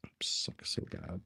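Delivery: ordinary delivery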